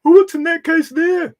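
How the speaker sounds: high, loud excited customer; eager fanboy voice. Retold or imitated. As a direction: squeal it like a thrilled fan. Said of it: "I'd always be saying to him".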